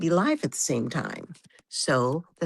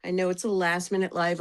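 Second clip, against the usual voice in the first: ordinary talk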